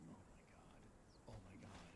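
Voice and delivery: low, very soft and slow; imitating quiet, sinking panic; retold hushed dread. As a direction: say it under your breath, dreading what's coming. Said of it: "You're panicking".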